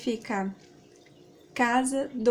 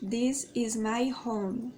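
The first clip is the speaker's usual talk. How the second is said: "This is my home" said very slowly, word by word; slow careful demo; much slower than usual. Shown as a demonstration. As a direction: spell it out slowly for learners. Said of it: "x0.5 repeat".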